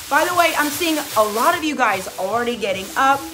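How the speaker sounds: ordinary delivery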